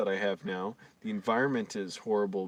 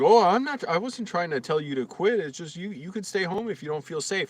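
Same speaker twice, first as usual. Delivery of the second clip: mock manager; 8 semitones higher, 3 dB louder than usual. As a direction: mimic her backpedalling sweetly. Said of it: "she was like".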